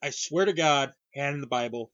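ordinary delivery

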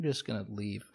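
low, very soft exit line; mock dejected mumble. Ignored Roz excuses herself, low dejected murmur. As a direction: slip it out low and quiet. Acting forgotten Roz slinking away.

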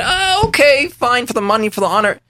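high, greedy "oh, okay"; eager acceptance. Retold or imitated. Young man accepting money and honor, high and eager. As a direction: grab the offer gladly.

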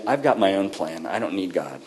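the honest aside under his breath; quiet candid confession; muttered real meaning. Retold or imitated. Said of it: "it's just a cloak for saying".

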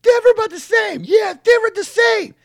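high shouted gruff catchphrase; wrestling promo voice. Retold or imitated. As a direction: bellow it like a meathead wrestler. Mocking Steiner catchphrase.

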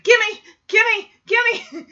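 high, loud grabby yells; shouted gimme. Retold or imitated. Danny just woken, yelling at Kimmy, loud and high. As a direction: shout it like a greedy kid waking up. Danny wakes, grabs at unicycle.

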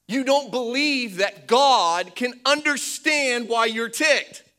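loud, insistent sermon delivery; emphatic preaching. Real, not acted. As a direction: declare it to the congregation with force. Preaches at full force.

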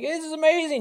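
a friend bragging in the group chat, excited; excited text. Retold or imitated. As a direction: squeal it like a thrilled friend. Friends texting about stock win.

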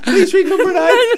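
high, loud begging through laughter; laughing plea. Real, not acted. Asking his friend to read the next letter, laughing. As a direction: beg him to read on, laughing.

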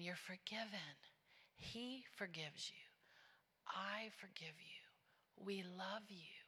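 hushed, whispered reassurance; tender whisper. Retold or imitated. Church girl forgiving the woman bawling in her arms, tender whisper. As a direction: whisper forgiveness to someone being held, with pauses between. Retells comforting the weeping woman.

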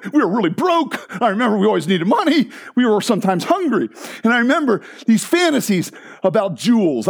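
ordinary talk

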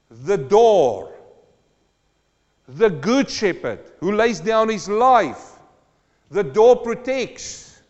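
full-throated pulpit preaching; preaching at full force. Acted. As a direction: declare it to the congregation. Preaching climax, declaring Psalm.

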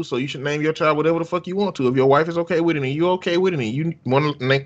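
ordinary delivery

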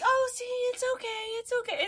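put-on high, gentle calming a child; soothing. Helper soothing crying child.

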